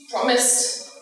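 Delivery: ordinary delivery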